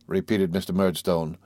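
ordinary delivery

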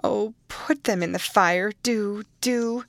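put-on girl urging the nurse to burn letters; begging. A narrator's reading. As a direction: beg urgently.

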